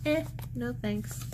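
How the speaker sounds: high-pitched, offhand mock brush-off; lightly mimicked shrug of a refusal; imitated dismissive refusal. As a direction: toss off a quoted "no thanks". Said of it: "the creator of this show was like".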